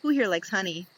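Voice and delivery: ordinary delivery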